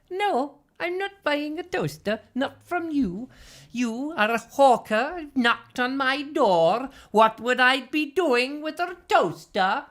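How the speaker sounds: high, slow, put-on accent drifting from Scottish; unplaceable mock accent. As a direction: slowly refuse in a hammed-up, high comic accent. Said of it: "I don't know what accent that was".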